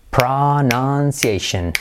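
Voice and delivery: "pronunciation" drawn out as pra-nan-siei-shen; slow teaching model of "pronunciation"; slow syllable drill. Shown as a demonstration. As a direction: stretch "pronunciation" slowly. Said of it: "Y ahora, pronon".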